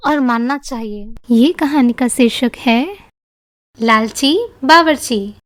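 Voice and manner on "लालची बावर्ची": ordinary delivery, nothing marked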